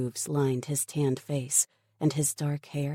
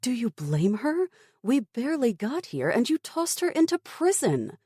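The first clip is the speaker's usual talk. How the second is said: higher and louder in protest; indignant; 10 semitones higher, 4 dB louder than usual. A narrator's reading. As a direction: challenge him hotly. Tara argues with the Major.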